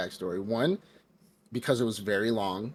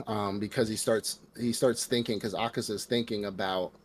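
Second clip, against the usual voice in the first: ordinary talk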